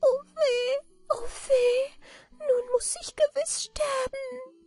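very high, tearful creature lament; wailing little manikin. A narrator's reading. Little man fears he must die, wailing. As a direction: wail it in a tiny falsetto. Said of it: "Da klagte das Männlein".

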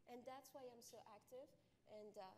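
ordinary talk, nothing marked

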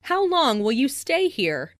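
ordinary talk, nothing marked

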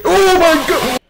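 very loud, high shriek; full startled fright; terrified scream. Real, not acted. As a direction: scream it at a sudden scare. Jump scare ends the game.

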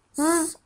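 Z as a held buzz, "zzz"; letter sound demo. Shown as a demonstration. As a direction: sound out Z with no vowel. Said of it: "diye okunur". (Z'yi "zzz" diye uzat)

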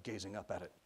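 ordinary delivery, nothing marked

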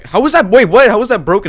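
loud, high disbelief; baffled outburst. Real, not acted. Gamer objecting that a pan can't be broken, baffled outburst.

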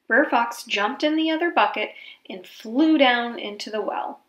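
usual speaking voice

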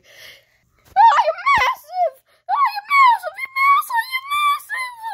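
very high squealed mock terror; hammed-up high-pitched screaming; panicked wail. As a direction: shriek it over and over in panic. Terrified reaction to huge opponent.

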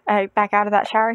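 ordinary talk, nothing marked

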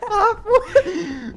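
slow, amused words dissolving into real laughter; laughing. Real, not acted. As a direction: laugh through the words, dragging them out. Amused by friend's phrase.

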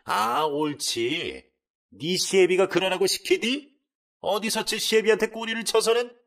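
slow sarcastic drawl rising into a loud, high accusation; mock her, then attack; scornful taunt turning to a shout; sneering accusation. Mother-in-law sneers then accuses.

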